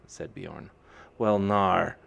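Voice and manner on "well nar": Lanrine drawl. A narrator's reading. Fiona answers.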